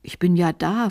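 plain reading voice

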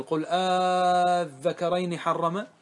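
"آذكرين" with the first vowel stretched long; drawn-out madd. Shown as a demonstration. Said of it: "يجوز فيه الوجهان". (مدّ الهمزة في "آذكرين" مدًّا طويلًا)

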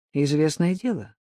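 plain reading voice

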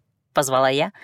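plain reading voice

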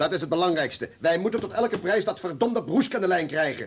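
high, loud, urgent order; commanding shout. Acted. Chief loudly orders his men to reach Broesk at any price. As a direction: bark the order across the room.

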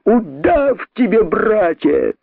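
high scornful cry; mob curse. A narrator's reading. Crowd cursing the traitor, scornful. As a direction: curse him out.

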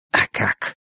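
curt, toneless retort; short, abrupt comeback; sharp question. A narrator's reading. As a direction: ask it sharply, without raising the volume. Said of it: "резко спросил Нуржан".

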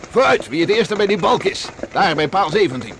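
loud, high racing cry to the horse; urging shout. Acted. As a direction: spur the horse on at full voice. (luid aansporend roepen naar het paard)

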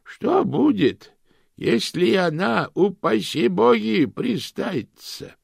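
put-on high, slow, cracked old lady. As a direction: croak like a tiny ancient aunt. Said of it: "послышался старый голос".

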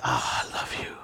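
soft whispered I love you; gentle whisper to the mirror. Retold or imitated. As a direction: whisper it tenderly to yourself. Tender self-talk in mirror.

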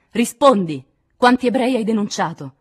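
loud, high-pitched interrogation; shouted, accusing question; furious demand. A narrator's reading. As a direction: demand an answer at full force. Violent interrogation continues.

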